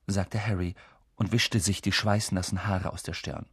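ordinary delivery

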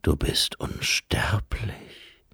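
low, whispered Death; slow, hollow whisper of Death; Death's voice. A narrator's reading. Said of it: "sagte Tod". (Tod, leise geflüstert)

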